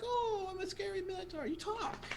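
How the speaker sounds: high, soft mock-scary child voice; mocking gnome kid. Gnome kid mocks the minotaur, high and soft. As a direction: kid teasing in a spooky tone. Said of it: "you're like".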